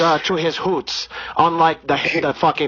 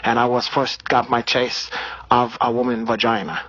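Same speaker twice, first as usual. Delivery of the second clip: put-on Brazilian accent. Comedian holding a put-on Brazilian accent.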